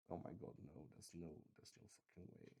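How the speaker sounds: fast breathless whisper of disbelief. Real, not acted. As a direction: hiss it under your breath, fast, in shock. Panicked aside at the roll.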